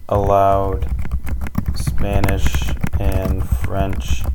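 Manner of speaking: slow and loud, with long gaps between phrases; dictating while typing. Real, not acted. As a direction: say it piece by piece as if typing it out. Typing the script aloud word by word.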